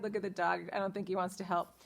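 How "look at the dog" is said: ordinary delivery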